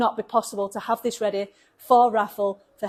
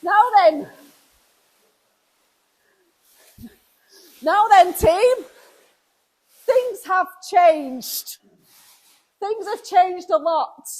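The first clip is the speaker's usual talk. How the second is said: higher, louder, thrilled announcement; excited; 7 semitones higher, 8 dB louder, slower than usual. Real, not acted. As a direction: call it out, bursting with joy. Excited greeting, things have changed.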